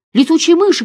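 high, loud frightened cry of bats; shout. Acted. Miriam screams about bats from the dark, loud frightened cry. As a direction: Miriam cries out in terror. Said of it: "кричала Мириам".

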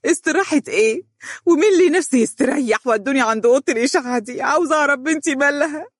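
frantic mother refusing to sit; nervous outburst. Acted. Mother demanding to see her daughter, high agitated outburst. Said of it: "قالت الأم بعصبية".

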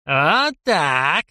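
put-on clapping, gloating old geezer; a hint of delighted cackle; gleeful old man. A narrator's reading. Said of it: "дедок захлопал в ладоши".

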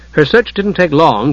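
plain reading voice

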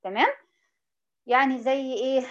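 usual speaking voice

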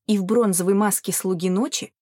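ordinary delivery

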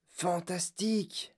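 awed murmur. A narrator's reading. Grandfather marvels at the rows of buttons, murmuring. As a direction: murmur it slowly in wonder. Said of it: "murmura grand-papa Joe".

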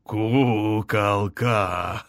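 very slow, stretched-out exclamation; gleeful villain greeting. A narrator's reading. Overlord gleefully greets the queen, drawling her pet name. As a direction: draw the pet name out with relish. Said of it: "рассмеялся повелитель".

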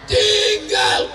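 final verdict shouted; loud high verdict. Real, not acted. (jawaban keras dan tinggi)

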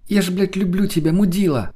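high, elated exclamation; shouted confession. A narrator's reading. Lena declaring love to her man, high mild shout. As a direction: cry it out as a giddy, rough declaration. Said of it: "крикнула она и захохотала".

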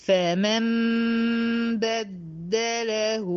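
slow chanted tajweed recitation; Quran recitation. Shown as a demonstration. Recites next verse. (تلاوة مجودة بطيئة)

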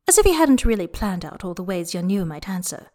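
plain reading voice

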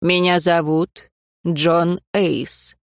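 slow, deliberate self-introduction. Acted. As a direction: say the name slowly. Man introduces himself slowly.

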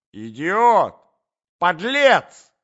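high, very loud single-word yells; shouted abuse. A narrator's reading. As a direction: scream each insult separately. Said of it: "кричала Марья Александровна".

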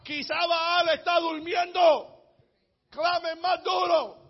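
high, slow jeering call; mocking taunt. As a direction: taunt them loudly, stretching the words. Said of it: "se burlaba de ellos y decía".

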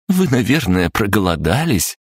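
high, caring canteen-lady voice; fussing cooks. A narrator's reading. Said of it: "Заботливые поварихи захлопотали". (заботливо, по-поварски)

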